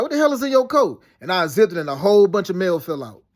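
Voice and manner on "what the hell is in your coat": higher-pitched, incredulous demand; imitating shocked disbelief; retold astonished question. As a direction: act out your startled reaction, pitched up. Retells startled question in dream.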